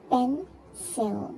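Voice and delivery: "Pencil" broken into two separate beats; syllable by syllable. Shown as a demonstration. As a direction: split "Pencil" between its syllables. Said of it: "two syllables".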